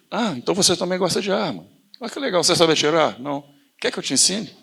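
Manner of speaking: high, bright feigned enthusiasm; spring the trap with fake cheer; playing friendly to win him over; mock delighted. Acted friendly trap in debate.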